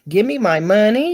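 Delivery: loud playful imitation, rising at the end; So Evil. Co-host imitating So Evil for laughs, hammed-up. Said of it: "in your So Evil voice".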